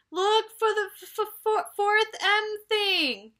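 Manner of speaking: high, stuttering on every jolt; high, jerky stammer from a bumpy ride; jolted, bouncing speech. Acted. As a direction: sputter it out as the buggy bounces. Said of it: "bouncing up and down".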